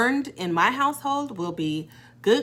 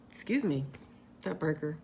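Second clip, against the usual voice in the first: ordinary talk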